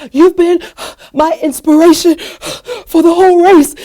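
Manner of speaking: loud, high words broken by acted gasps; panting. Winded runner praising a stranger mid-race, imitated panting. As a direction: pant hard between phrases like a spent runner. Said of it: "and she's panting".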